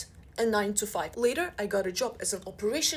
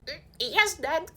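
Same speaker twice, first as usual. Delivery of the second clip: much higher, louder mock-meek yes; sheepish daughter; 7 semitones higher, 5 dB louder, slower than usual. Daughter caught wearing Gucci by dad, mock-meek. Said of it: "I'm like".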